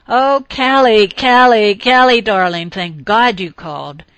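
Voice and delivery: gushing. Acted. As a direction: pour it all out, slow and loud, over and over. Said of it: "David gushed".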